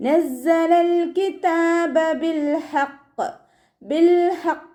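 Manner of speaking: slow, high, melodic tajweed chant; Quran recitation. Shown as a demonstration. Teacher chants the verse for students, slow and melodic. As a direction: draw each syllable out in a sung recitation. Recites phrase to show the stop.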